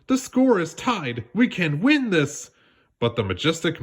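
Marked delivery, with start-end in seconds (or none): 0.1-2.5 excited rallying. A narrator's reading. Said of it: "exclaimed Jasmine".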